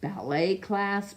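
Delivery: usual speaking voice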